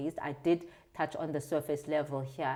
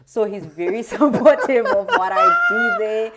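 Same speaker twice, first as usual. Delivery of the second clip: laughing; 5 semitones higher, 14 dB louder than usual. Real, not acted. Laughs while praising husband.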